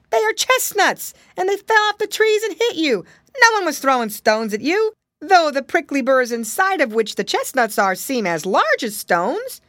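high, loud cartoon monkey voice; eager monkey. A narrator's reading. Monkey explains the falling chestnuts to piggy boy, high and eager.

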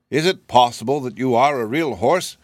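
usual speaking voice

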